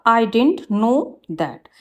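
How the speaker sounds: slow, careful read of the corrected sentence; slow teacher dictation. Shown as a demonstration. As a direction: lay out each word clearly for the class. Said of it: "right होगा".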